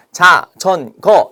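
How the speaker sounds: 자, 전, 거 as three separate beats; syllable by syllable. Shown as a demonstration. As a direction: break it into 자, 전, 거 with a gap after each. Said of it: "가장 작은 단위니까".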